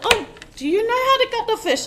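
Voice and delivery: high, loud mock voice of a surprised older man; imitating father-in-law. Daughter-in-law retells father-in-law questioning her, high mock voice. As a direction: play the doubtful father-in-law, lightly hammed up.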